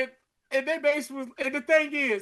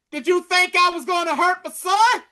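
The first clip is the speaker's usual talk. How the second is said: much higher and louder, pitch jumping up; unhinged outburst; 9 semitones higher, 7 dB louder than usual. Retold or imitated. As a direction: throw it at her like a crazed man. Unhinged Gary confronting her.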